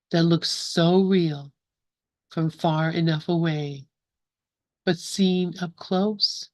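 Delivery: slow, measured recital with long pauses between lines; slow poem reading. Real, not acted. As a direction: read each line slowly, leave room to contemplate. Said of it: "gonna read the poem slowly".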